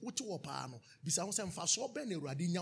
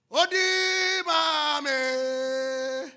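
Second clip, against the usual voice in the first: very slow, higher, loud held note; drawn-out call; 8 semitones higher, 9 dB louder, much slower than usual. Real, not acted. Preacher to congregation, long held call.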